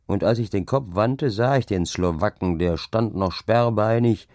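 plain reading voice

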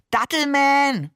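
high, drawn-out superhero-style cry; delighted call. A narrator's reading. Seestern names his date figure, delighted call. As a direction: call it out slowly, stretching the name. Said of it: "rief der Seestern".

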